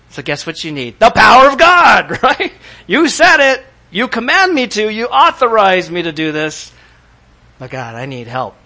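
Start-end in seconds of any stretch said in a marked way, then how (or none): 1.0-6.7 loud, high preaching peak; fiery pulpit cry; preaching at full force. Acted. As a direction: shout it out to the church. Preaching climax on God's power.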